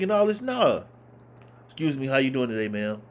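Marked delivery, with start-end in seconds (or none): 1.7-3.0 lower, quick, polite voice; polite proper talk. Shown as a demonstration. Showing how you talk with sense to adults, polite and lower. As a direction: speak properly and politely to an elder. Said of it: "you talk with sense".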